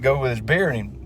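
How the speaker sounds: ordinary talk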